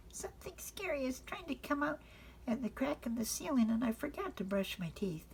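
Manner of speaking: put-on high, hushed little girl voice, worried; a frightened child at bedtime; scared little girl. Frances telling Father something scary is coming.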